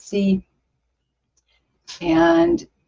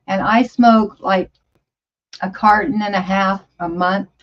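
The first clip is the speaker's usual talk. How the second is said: ordinary delivery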